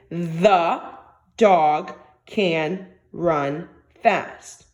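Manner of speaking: each word spaced out, very slow; very slow dictation. Shown as a demonstration. Teacher to young students, dictating the sentence very slowly. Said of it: "Again, say it with me".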